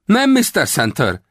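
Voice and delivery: high, loud cry of recognition; exclaimed. A narrator's reading. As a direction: call out his name eagerly. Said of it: "kiáltott fel Rawlins". (magas, hangos felkiáltás)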